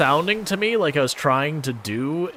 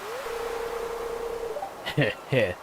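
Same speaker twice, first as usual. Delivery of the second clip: high squealing laugh; 9 dB quieter than usual. Real, not acted. High burst after joke.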